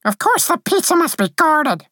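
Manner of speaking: very high little-creature voice, snappy; high, quick-tempered but fond sprite; affectionate snap. A narrator's reading. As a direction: retort in a tiny high voice. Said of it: "she snapped affectionately".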